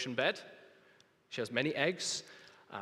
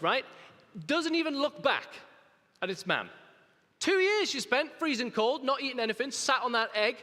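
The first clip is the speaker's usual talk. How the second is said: rising, louder indignant preaching; 7 semitones higher, 5 dB louder than usual. Acted. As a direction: preach it with mock outrage. Indignant preaching about ungrateful octopus.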